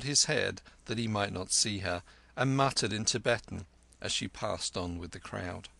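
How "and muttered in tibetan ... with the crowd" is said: plain reading voice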